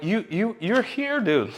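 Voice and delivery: high, incredulous "you're right here"; mock disbelief at a friend; exasperated disbelief. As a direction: scold a friend who's missing the obvious. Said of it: "I'm like".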